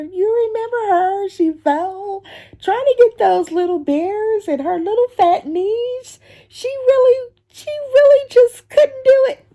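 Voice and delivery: high mocking little kids' voice; mocking kids. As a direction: imitate kids gossiping about the fat lady. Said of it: "I can see them now".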